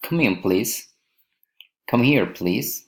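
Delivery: "please" lifted at the end of each request; rising request intonation. Shown as a demonstration. Teacher models polite requests, rising on "please". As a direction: let the pitch climb on "please". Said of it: "rising intonation".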